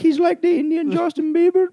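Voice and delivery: very high giddy fangirl gush; excited fangirls. Retold or imitated. Girls going crazy over the lookalike, very high gush. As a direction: gush it like a screaming fan. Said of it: "they're going crazy like".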